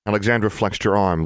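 ordinary delivery, nothing marked

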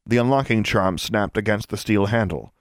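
usual speaking voice

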